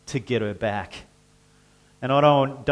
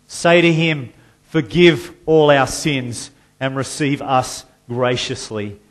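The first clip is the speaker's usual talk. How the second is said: loud prophetic call; 2 semitones higher, 7 dB louder than usual. Acted. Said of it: "It says".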